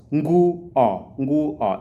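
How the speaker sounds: "Nguo" as two syllables, ngu-o, with no extra vowel in front; "Nguo": start right on the ng; correct nguo. Shown as a demonstration. Teacher giving the right form against a student's error, firm. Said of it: "It's not nguo".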